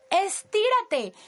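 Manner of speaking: agudo, fuerte y lento; ordénalo con energía; grito enérgico. Shown as a demonstration. Repite el grito más corto. (¡estírate! gritado)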